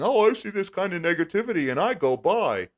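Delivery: high, sing-song mimicry of a commenter; mocking imitation. Streamer mimics smug dip buyers in comments, mocking imitation.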